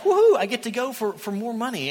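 high whoop, lightly acted; mock glee. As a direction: play an eager, carefree cheer.

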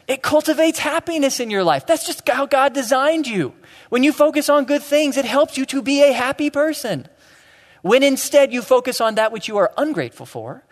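high, loud, driving sermon; impassioned preaching. Real, not acted. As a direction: build it with conviction. Preacher drives home the lesson.

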